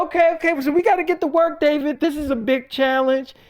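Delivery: rally the team for the big job; hyped staff. Retold or imitated.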